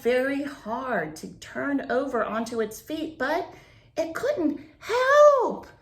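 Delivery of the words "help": high, loud cry for help; cried out. A narrator's reading. Beetle stuck on its back, crying for help loudly. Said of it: "it cried".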